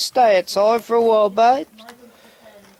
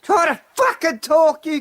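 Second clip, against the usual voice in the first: angry yell; 10 semitones higher than usual. Real, not acted. Man yelling at crowing roosters mid-video, furious shout. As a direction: bark it out, fed up. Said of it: "I'm just yelling at the jokes".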